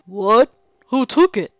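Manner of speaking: loud shouted question; shouting. Acted. Seven demands, shouting.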